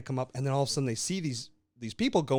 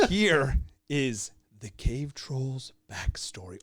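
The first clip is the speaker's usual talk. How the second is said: slow, breathy storyteller whisper; spooky campfire whisper; slower than usual. Acted. Telling his friend a spooky troll backstory, whispered. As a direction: hush it like a ghost-story host. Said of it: "Are You Afraid of the Dark?".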